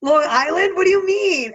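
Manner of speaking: high, loud questioning; baffled protest. Real, not acted. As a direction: push it up in loud disbelief. Baffled by the pronunciation lesson.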